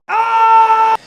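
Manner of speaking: very high, loud sustained shriek; startled scream. Acted. Comedian surprised by the president behind him, shrieking. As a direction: scream in shock, long and piercing.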